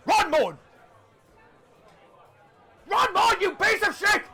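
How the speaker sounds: high, loud shout across the bar; yelling at the helper. Acted. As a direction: bellow at the lazy helper, half laughing. Innkeeper yelling at his helper.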